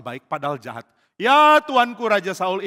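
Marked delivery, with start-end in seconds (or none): 1.1-2.7 slow, high, loud answer; drawn-out respectful holler; calling reply. Acted. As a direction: answer the king across a distance. Daud menyahut raja dari jauh.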